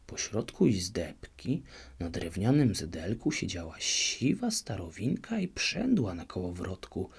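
usual speaking voice

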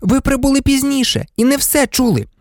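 put-on high, matter-of-fact girl's voice; frank, high-pitched rebuttal. A narrator's reading. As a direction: correct a teacher candidly. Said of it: "відверто сказала Герміона".

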